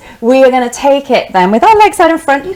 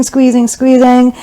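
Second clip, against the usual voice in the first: ordinary talk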